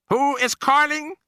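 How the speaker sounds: high, slow, sharp witch voice; witch snapping. A narrator's reading. Grand High Witch demanding who calls, sharp witch voice. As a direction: snap it out like a haughty witch. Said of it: "snapped the Grand High Witch".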